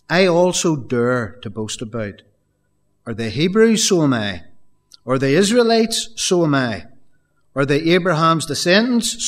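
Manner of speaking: rising, insistent scripture reading; fervent preacher reading; preaching scripture. Acted. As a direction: build Paul's questions with fire. Reads Paul's words aloud.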